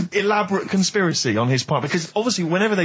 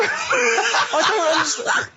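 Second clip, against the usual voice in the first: laughing; 12 semitones higher, much slower than usual. Real, not acted. Cohost laughing at the theory.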